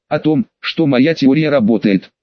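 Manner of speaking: plain reading voice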